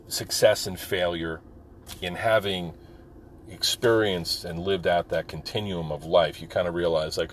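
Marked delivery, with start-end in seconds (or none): none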